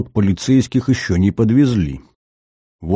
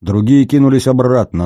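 plain reading voice

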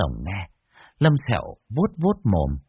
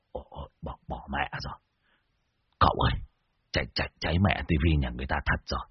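dismayed gasp; 8 semitones lower, 7 dB quieter, faster than usual. Acted. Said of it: "Muôn Phọt há hốc mồm".

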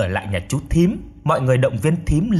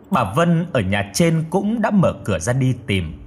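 plain reading voice

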